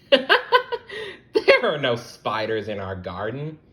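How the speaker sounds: slow, laughing reassurance; amused laughing. Acted. Hermie calming the ladybugs, laughing slowly. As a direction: chuckle through the words.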